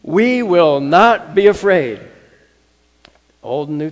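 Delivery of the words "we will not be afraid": much louder, higher, slow proclamation; rallying the congregation to shout it; louder declaration. Shown as a demonstration. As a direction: proclaim it at full voice. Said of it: "let's say it again".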